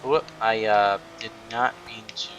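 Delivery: usual speaking voice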